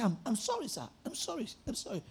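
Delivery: soft, small, mock-humble sorry; mock meek apology. Preacher mocking his own fake apology to a rude caller, meek and soft. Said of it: "I said".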